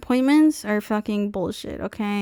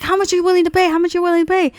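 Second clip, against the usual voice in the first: higher, pushy salesman voice; mock salesman; 8 semitones higher, 4 dB louder, much faster than usual. Mimicking the salesman asking what she'll pay, high and pushy. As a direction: imitate the salesman's pressing question. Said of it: "He's like".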